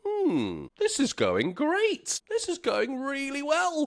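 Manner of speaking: high, pleased inner voice; pleased inner thought. Retold or imitated. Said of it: "And I thought".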